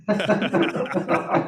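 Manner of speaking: big bright laugh; hearty laugh. Real, not acted. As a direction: burst out laughing. Laughs after the intro.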